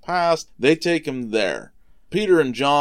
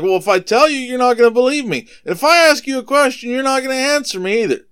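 fast, high and loud comeback; raised voice, hurrying through the argument; exasperated retort; 12 semitones higher, 6 dB louder, faster than usual. Retold or imitated. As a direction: rattle off an acted complaint to the council. Jesus retorts to the council.

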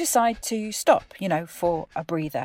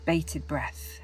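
poem recitation; 4 dB quieter than usual. Acted. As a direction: let each breath phrase land alone over the music.

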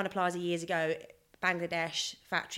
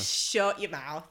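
mock disbelief; much slower than usual. Real, not acted. Mock disbelief at four languages.

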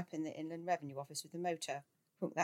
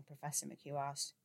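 usual speaking voice

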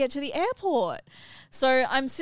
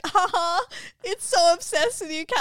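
teasing laugh; 10 semitones higher, 5 dB louder than usual. Retold or imitated. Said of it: "Mel's like".

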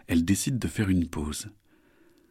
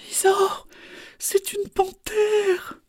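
very high, drawn-out frightened cry; alarmed; much slower than usual. Acted. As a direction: warn in horror. Lulu alarmed at the panther.

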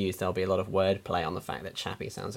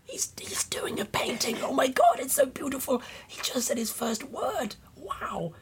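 awestruck whisper; slower than usual. Retold or imitated. Mock trailer awe.

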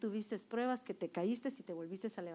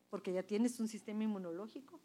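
usual speaking voice

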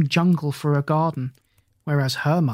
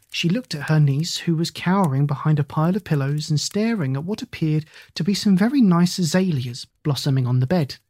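plain reading voice

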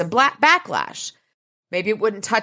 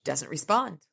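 usual speaking voice

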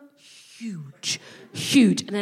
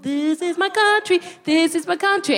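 higher, triumphant claim; proud arrival claiming her country; proud declaration; 8 semitones higher than usual. Retold or imitated. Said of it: "So I turned up like".

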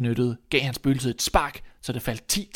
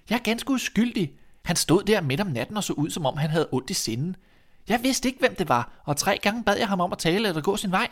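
put-on high-pitched boy pleading his case; protesting innocence; 7 semitones higher than usual. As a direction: defend yourself in a high voice. Boy defends himself to father. (uskyldig, høj drengestemme)

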